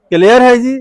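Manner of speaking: ordinary talk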